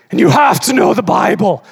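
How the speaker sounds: booming mock insistence; mock shout. Mocking a preacher demanding to the congregation, loud. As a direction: hammer it like a scold.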